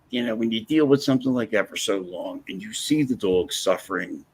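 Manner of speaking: normal talking voice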